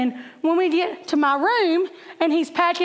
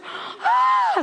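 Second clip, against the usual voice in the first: mock squeal of a proud mom; imitated squeal. Mom reacting to son's touchdown, imitated squeal.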